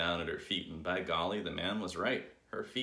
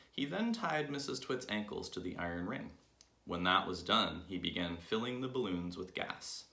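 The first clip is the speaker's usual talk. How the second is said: plain reading voice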